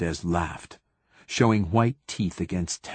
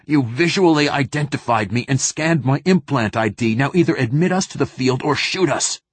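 higher, forceful angry demand; raised voice, out of patience; snapped ultimatum; 5 semitones higher, 4 dB louder than usual. A narrator's reading. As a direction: lay down an ultimatum to a subordinate. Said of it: "snapped Theo in a tone".

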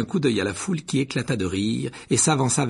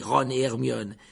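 ordinary delivery, nothing marked

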